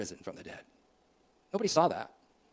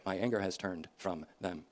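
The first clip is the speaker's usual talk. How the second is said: soft echo; 6 semitones lower, 8 dB quieter than usual. Shown as a demonstration. Preacher echoing Hosea's verse, hushed and softer. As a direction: repeat the verse much softer, almost to yourself. Echoes the verse more softly.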